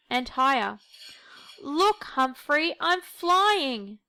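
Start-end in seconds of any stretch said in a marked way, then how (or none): 1.6-4.0 high, slow, thrilled call; gleeful squeak. Acted. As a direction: squeak it out in delight. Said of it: "Millie squeaked".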